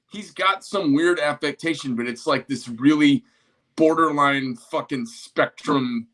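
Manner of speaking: usual speaking voice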